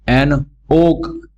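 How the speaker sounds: एन ओक stretched out very slowly; slow model phrase. Shown as a demonstration. Teacher modelling एन ओक slowly for students. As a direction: draw out एन ओक for the class.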